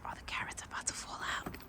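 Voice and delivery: real whisper, voice dropped right down; hushed whisper. Real, not acted. Mom whispering to viewers while the deer eats nearby, hushed whisper. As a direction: breathe it so the deer isn't startled. Watching deer, not to scare it.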